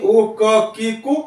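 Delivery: normal talking voice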